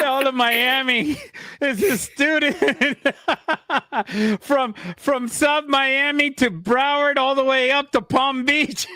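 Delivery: laughing. Real, not acted. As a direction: giggle your way through the teasing. Host laughs at guest's claim.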